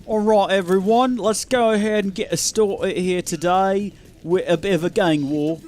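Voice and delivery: hammed-up announcer in a half-British accent; botched attempt at a British accent. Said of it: "That was an attempt at an accent".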